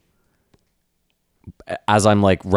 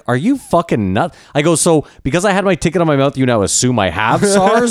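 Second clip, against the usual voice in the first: higher-pitched, incredulous protest; retold indignation; 7 semitones higher, 4 dB louder than usual. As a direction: argue as if this is absurd. Retold argument with bus driver.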